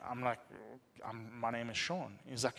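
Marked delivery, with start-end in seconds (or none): 1.1-2.2 low, quiet, halting introduction; retold sheepish mumble, dropped right down; hesitant reply. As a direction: answer small and uncertain. Taken aback by eager stranger.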